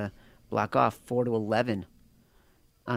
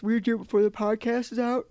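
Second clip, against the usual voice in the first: words stretched by a yawn; 9 semitones higher than usual. Real, not acted. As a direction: talk through a yawn. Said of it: "Sorry, I'm yawning".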